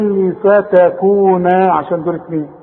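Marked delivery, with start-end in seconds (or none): 0.3-1.5 فتكون slow, -naa held long; full final alif. Shown as a demonstration. Quran teacher explains the dual in فتكون, long final alif. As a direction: finish فتكون on a drawn-out naa. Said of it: "خد بالك عند الوصل وعند الوقف".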